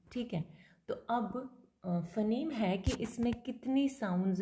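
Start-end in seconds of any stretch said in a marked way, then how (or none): none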